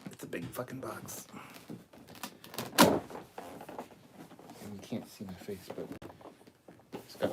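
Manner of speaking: amused whisper. Real, not acted. Showing viewers the big toy box in an amused whisper. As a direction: whisper it like a secret aside.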